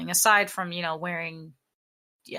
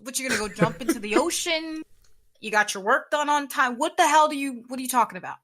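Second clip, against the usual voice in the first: higher-pitched, incredulous mock outburst; exasperated retort; 5 semitones higher, faster than usual. Answering someone's dangling so, mock exasperated. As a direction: fire back in acted exasperation.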